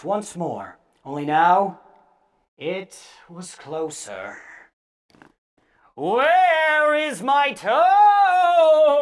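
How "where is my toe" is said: very slow, higher, loud groaning howl; loud ghostly groan. A narrator's reading. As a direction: howl it long and louder, the thing is closer. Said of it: "it groaned".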